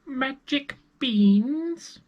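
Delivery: put-on very high, slow creaky old beggar. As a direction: stretch it out in a creaking beggar's croak. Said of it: "said the beggar with a creaky voice".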